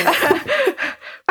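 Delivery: high burst of laughter. Real, not acted. Laughing at the hard question.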